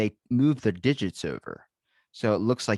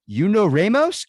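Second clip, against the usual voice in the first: high, loud, drawn-out disbelief; shocked question; 10 semitones higher, 9 dB louder, slower than usual. Acted. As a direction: act a startled scammer blurting a question. Scammers startled at boss's name.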